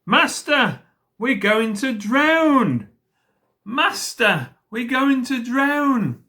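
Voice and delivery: high, loud, drawn-out wail; storybook wail of alarm; frightened cry. A narrator's reading. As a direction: cry out in exaggerated panic, stretching the words. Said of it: "and cried".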